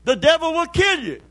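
high, loud fiery preacher's cry; preaching at full force. Acted. Preacher crying out to the church, fiery and loud. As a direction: thunder it at the congregation.

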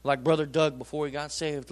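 ordinary talk

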